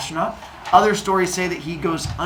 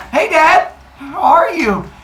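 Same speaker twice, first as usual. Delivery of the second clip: high, loud, fake-cheery hello; mock innocent son; 10 semitones higher, 6 dB louder, slower than usual. Son Cronus ambushing his father, high fake-cheery greeting. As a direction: play innocent while ambushing.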